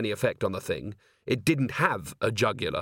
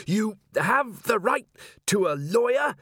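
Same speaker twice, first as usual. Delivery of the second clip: slow, high, effortful words; words forced out in pieces, pitched high; straining recital; 10 semitones higher, slower than usual. A narrator's reading. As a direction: recite it while wrestling a giant. Constable recites rights while grappling.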